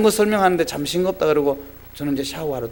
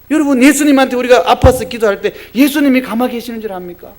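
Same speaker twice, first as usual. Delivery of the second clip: higher, louder fervent sermon climax; impassioned preaching; 6 semitones higher, 6 dB louder than usual. Acted. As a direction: drive the point home to the church. (열정적인 설교)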